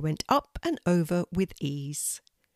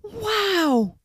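high, long falling wow; thrilled boy's wow; amazed exclamation. A narrator's reading. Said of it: "exclaimed Beckett".

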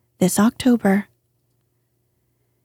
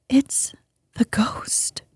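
frightened hush, higher then dropping to a whisper; a scared girl breathing out the news; trembling voice; 4 semitones higher, 6 dB quieter, much slower than usual. Acted. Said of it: "in a trembling voice".

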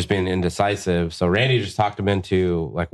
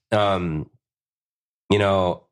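ordinary talk

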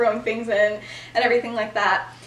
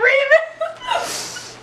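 calling out Remus. Retold or imitated. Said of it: "and everybody's like".

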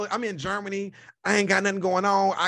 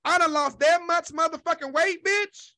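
shocked outburst; 8 semitones higher than usual. Retold or imitated. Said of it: "I'm like".

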